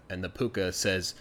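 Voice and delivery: ordinary delivery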